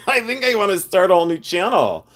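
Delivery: real laugh running into the words; amused, talking through a laugh. Real, not acted. Laughs at own swearing channel idea.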